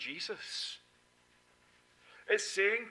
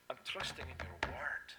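whispered trust; soft whisper; 3 semitones lower, 8 dB quieter than usual. Acted. End of voiced prayer.

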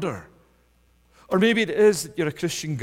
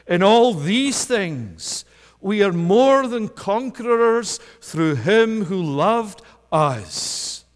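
triumphant proclamation; 6 semitones higher, 7 dB louder, slower than usual. Acted. Quoting Paul's triumphant claim.

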